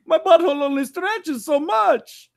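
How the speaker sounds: slow, high, whiny character voice; Ramses voice. Acted. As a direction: whine it slowly in character. Continuing the Ramses bit.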